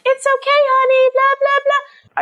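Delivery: very high singsong coddling; mock soothing. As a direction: imitate a too-sweet reassuring midwife. Imitates a hypothetical soothing midwife.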